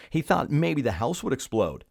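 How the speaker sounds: usual speaking voice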